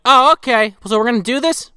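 high, imitated girl's voice; Molly. Molly asking her father, high imitated voice. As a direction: pitch it way up, mock-innocent questioning. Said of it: "And then Molly's like".

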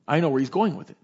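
ordinary talk, nothing marked

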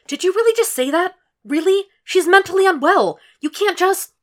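high, loud, indignant daughter; exasperated young woman yelling into a phone; upset phone argument. A narrator's reading. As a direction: protest loudly up high to her mother. Arguing with mother on phone.

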